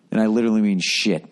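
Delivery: ordinary talk, nothing marked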